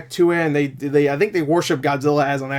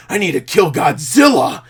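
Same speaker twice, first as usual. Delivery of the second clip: whiny voice. Retold or imitated. Main character declaring his mission, put-on whiny voice. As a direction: whine it out, hammed up. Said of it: "that kind of whiny voice".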